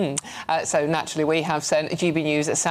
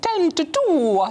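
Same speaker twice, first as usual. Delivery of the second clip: slow, much higher hammed-up Scottish accent; mock Scottish accent; 11 semitones higher, 3 dB louder, much slower than usual. Presenter teasing her co-host's time check, slow mock Scottish accent. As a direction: stretch "ten to two" out in a playful fake Scottish lilt. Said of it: "Very Scottish there".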